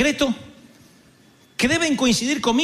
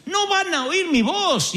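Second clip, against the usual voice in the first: higher, slow, pleading protest; pleading Moses; 9 semitones higher than usual. Retold or imitated. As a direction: beg off as a reluctant Moses. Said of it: "Oigan a Moisés decirle a Dios". (Moisés suplicando, agudo y lento)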